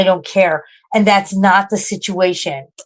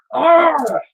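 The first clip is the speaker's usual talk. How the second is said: high angry cry of frustration; frustrated cry; 9 semitones higher, 3 dB louder than usual. Real, not acted. Streamer raging at herself over the spill, frustrated cry. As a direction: let it burst out of you. Self-loathing outburst.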